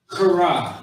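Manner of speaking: kara forced out with a scraped, throaty start; loud guttural opening on "kara"; from the throat. Shown as a demonstration. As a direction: cough up the first sound of "kara". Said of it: "with your damn throat".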